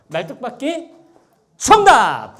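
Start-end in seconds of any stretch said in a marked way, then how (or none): 1.6-2.3 loud. Real, not acted. Host announcing correct answer.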